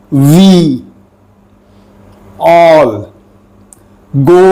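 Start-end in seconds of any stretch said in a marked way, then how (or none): none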